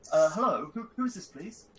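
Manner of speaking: high posh voice, loud hello; incredibly posh. Acted. Answering the police at the door, incredibly posh. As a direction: put on an over-posh, high-pitched English. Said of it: "actually my incredibly posh voice now".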